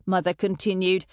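ordinary delivery

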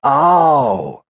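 very high, drawn-out doubt; disbelieving. A narrator's reading. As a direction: let the pitch leap up in disbelief. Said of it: "not believing him".

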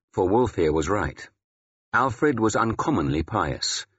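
ordinary delivery, nothing marked

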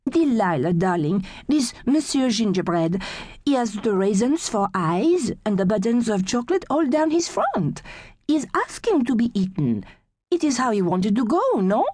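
put-on light French accent, fond and knowing; French accent, affectionate; French-accented Gigi. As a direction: play Gigi with a French lilt. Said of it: "She says".

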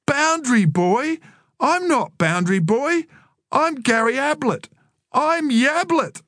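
high-pitched indignant little brother; indignant kid's complaint; complaining. A narrator's reading. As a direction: protest the nickname in a high, outraged voice. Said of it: "Joel's turn to complain".

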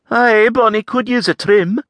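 high, lilting Scots lass; Avril's voice. A narrator's reading. Scottish lass answering the stranger. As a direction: voice the saucy Scottish farm girl.

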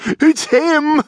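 very high, squealed alarm; high-pitched terrified thief; panicked scream. A narrator's reading. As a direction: cry out in acted panic. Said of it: "screamed Stefan, panic in his voice".

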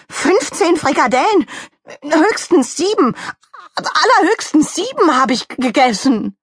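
high, spluttering denial; indignant protest. Acted. Donald Rabbit insisting on seven at most, indignant. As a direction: stumble over words in indignation.

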